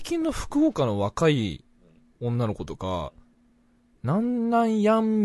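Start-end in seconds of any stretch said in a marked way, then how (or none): 4.0-5.2 high, slow imitation of young Fukuoka women; lightly mimicked young Fukuoka woman; young Fukuoka speech. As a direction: draw out the Fukuoka "やん". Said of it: "みたいな感じで話すんよ".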